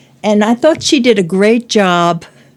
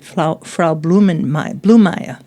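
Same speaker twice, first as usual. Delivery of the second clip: usual speaking voice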